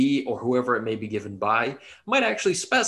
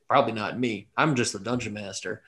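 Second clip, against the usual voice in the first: normal talking voice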